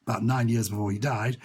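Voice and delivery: normal talking voice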